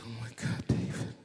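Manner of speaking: soft, whispery mock weeping; retold crying wife. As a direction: imitate a wife crying, barely voiced. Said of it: "And she's crying".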